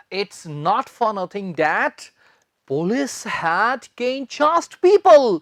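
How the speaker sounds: very slow, word by word; slow dictation. Shown as a demonstration. Teacher dictates the phrase slowly for note-taking. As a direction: spell it out slowly for students to write. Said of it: "और आगे लिख देंगे".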